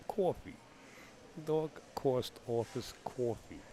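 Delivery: exaggerated, slow Bronx vowels; Bronx accent. Shown as a demonstration. Commentator hamming up his word list, thick Bronx accent. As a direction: stretch every vowel in broad Bronx. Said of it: "That's a certified Bronx accent".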